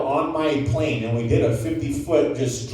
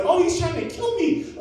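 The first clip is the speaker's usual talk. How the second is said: high-pitched comic squeal of realization; mock panic, voice shooting up; retold alarm; 13 semitones higher, 3 dB quieter than usual. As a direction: squeak it in sudden fright. Realizes the gunman will shoot.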